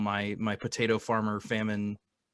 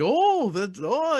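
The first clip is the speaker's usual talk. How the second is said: short high Irish-accent noises; a quick mock Irish brogue sound; 13 semitones higher, 4 dB louder, much slower than usual. Shown as a demonstration. Host showing his Irish accent works without real words. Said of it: "if I'm just going like".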